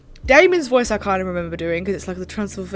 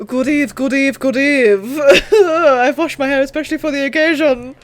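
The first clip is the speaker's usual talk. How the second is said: high, loud Transylvanian vampire; hammy Transylvanian accent, pitched up; Transylvanian Dracula voice; 4 semitones higher, 6 dB louder than usual. Acted. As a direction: greet like a theatrical Transylvanian Dracula. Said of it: "Transylvanian Dracula voice".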